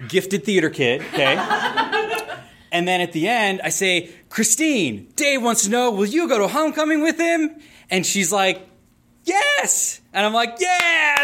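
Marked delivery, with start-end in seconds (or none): 4.3-7.5 rising, higher and higher announcement; theater kid asking for Dave; showman proposal. Retold or imitated. Big public homecoming proposal. 9.2-10.0 very high squealed yes; the girlfriend squealing yes; mock joyful yes. Girl saying yes. 10.6-11.2 high whooping yeah; the proposer cheering; triumphant cheer. Retold or imitated. Cheering the yes.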